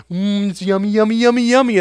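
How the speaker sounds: put-on rising sing-song "yummy, yummy" to a baby; mock coaxing parent. Parent spoon-feeding a baby, fake cheerful coaxing.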